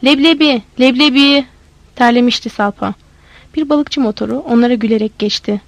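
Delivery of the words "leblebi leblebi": mild shout, voice raised to carry; louder street cry. A narrator's reading. Salpa obeys Kıvırcık's demand for more volume, calling out louder. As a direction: call it out towards the far shore. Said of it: "Daha yüksek, karşı kıyıdakiler duyacak seni".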